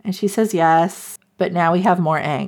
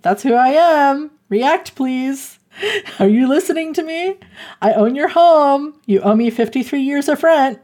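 higher-pitched, exasperated duke; insistent demand; 7 semitones higher than usual. Retold or imitated. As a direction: press hard for a reaction, voice raised in pitch. Acts duke demanding a reaction.

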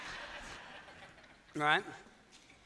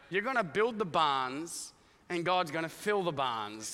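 normal talking voice

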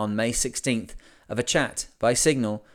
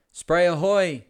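called out loud across the water; hailing call; 6 semitones higher, 3 dB louder, slower than usual. A narrator's reading. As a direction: hail the passing boat. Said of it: "they all hailed now".

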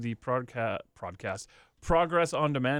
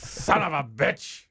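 slow drawn-out growl of a wrestling boss; Vince McMahon impression; much slower than usual. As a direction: stretch each word like an angry promoter. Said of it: "we were doing Vince McMahon".

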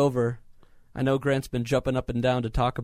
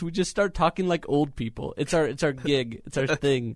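ordinary talk, nothing marked

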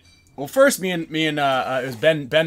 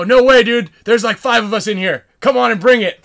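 loud, high reenacted bravado; hollered trash talk, retold; taunting shout; 7 semitones higher, 11 dB louder than usual. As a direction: dare them to attack, voice raised. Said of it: "talking shit to 'em".

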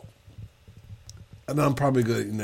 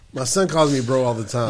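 ordinary talk, nothing marked

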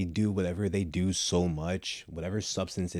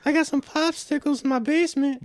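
put-on Family Guy old man voice; 5 dB louder than usual. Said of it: "That does kinda sound like him, but without the whistling".

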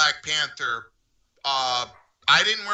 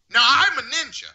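normal talking voice

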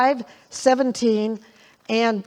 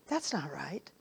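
very low, soft aside; hushed objection; 8 semitones lower, 16 dB quieter than usual. Retold or imitated. Preacher retells reacting to false righteousness teaching, hushed objection. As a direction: imitate objecting quietly under your breath.

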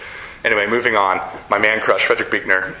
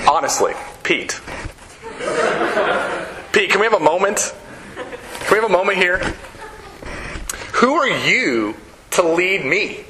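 amused scoff, higher and laughing through it; 4 semitones higher than usual. Retold or imitated. Jesus scoffing at Peter, with a laugh. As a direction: laugh him off like a friend. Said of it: "Jesus scoffs and goes".

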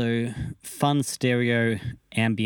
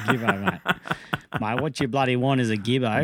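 mock Aussie bloke; 2 semitones higher than usual. Mocking Queensland guitar buyers.

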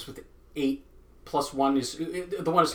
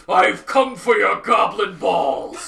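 slow, loud, laughing battle cry; deepest voice; 6 semitones higher, 7 dB louder, much slower than usual. Pretend. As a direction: bellow it out as a warrior. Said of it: "the deepest voice I can".

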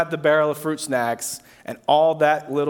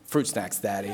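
put-on toddler voice; 4 semitones lower, 8 dB quieter than usual. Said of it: "And he says".

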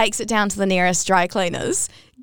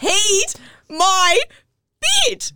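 much higher and louder, peed my bed; squealing punchline, pitch leaping up; outraged high punchline; 11 semitones higher, 4 dB louder, much slower than usual. Real, not acted. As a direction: shriek the reveal in disbelief. Punchline of pee story.

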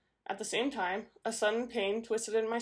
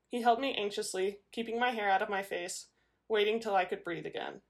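plain reading voice

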